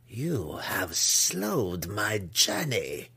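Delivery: very slow, calm matriarch's voice; stately, measured calm. A narrator's reading. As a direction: draw each word out, unruffled and in command. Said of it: "Malice said calmly".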